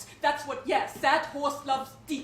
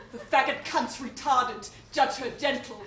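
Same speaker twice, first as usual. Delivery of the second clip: ordinary talk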